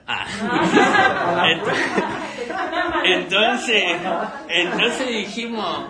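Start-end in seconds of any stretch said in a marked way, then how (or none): 0.3-5.9 high, talking through laughter; real laughter. Real, not acted. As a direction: try to resume the story while laughing. Storyteller cracking up while continuing.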